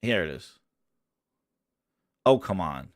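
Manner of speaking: very low, soft grumble; under the breath, pitched well down; muttering to self. Real, not acted. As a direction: grumble quietly at an uncooperative screen. Muttering to self while searching screen.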